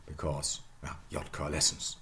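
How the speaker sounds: normal talking voice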